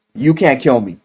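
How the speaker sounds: ordinary talk, nothing marked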